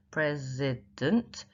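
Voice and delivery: "president" very slowly, each syllable drawn out. Shown as a demonstration. Teacher showing the unstressed last syllable, "president" slow and syllabified.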